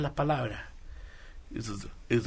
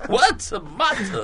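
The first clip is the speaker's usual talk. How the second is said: mock drawn-out higher take; 7 semitones higher, 4 dB louder, much slower than usual. Shown as a demonstration. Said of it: "en distintas circunstancias".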